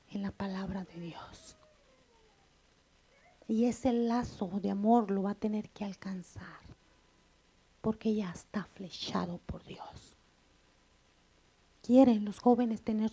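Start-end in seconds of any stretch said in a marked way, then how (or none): 0.1-10.1 breathy hushed preaching whisper. Real, not acted. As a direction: drop to a whisper to draw them in. Intimate point in sermon. (predicando en susurro)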